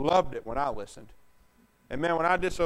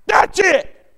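much higher, much louder exploding yell; angry shout; 14 semitones higher, 15 dB louder than usual. Retold or imitated. Parent snapping at a child after repeated warnings, shouting. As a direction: blow up at the disobedient kid.

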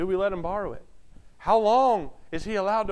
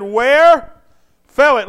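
loud, higher, urgent question; loud prophet quote; 7 semitones higher, 13 dB louder, much slower than usual. Acted. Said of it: "The man of God said".

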